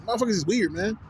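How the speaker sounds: normal talking voice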